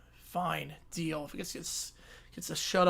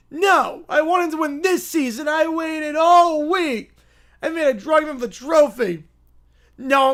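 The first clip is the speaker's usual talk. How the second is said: put-on upset kid shout; 5 semitones higher, 5 dB louder than usual. Kid upset about Chase Elliott.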